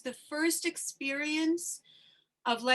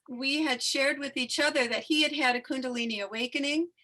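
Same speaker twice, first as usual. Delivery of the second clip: ordinary delivery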